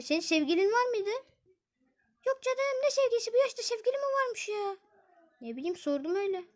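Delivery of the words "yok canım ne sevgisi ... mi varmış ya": put-on high, dismissive girl voice. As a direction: brush off the question as the girl. Zeynep denies having a boyfriend.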